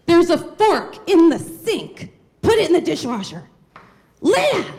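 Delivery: shrill high shout of an exasperated grandma; imitating a nagging grandmother's scream. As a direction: yell the name across the house. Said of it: "communicated was through screaming".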